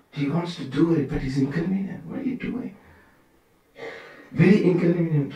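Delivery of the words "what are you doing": soft unvoiced whisper; whispered what are you doing; whispered question. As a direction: breathe the question like a confidential rebuke. Narada's question repeated, hushed.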